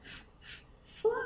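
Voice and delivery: f-, f-, fly, the F sound whispered then the word. Shown as a demonstration. Teacher sounding out the first letter for kids, f then fly. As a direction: sound out "F-, f-, fly" starting with a breathy f. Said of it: "It's a fly".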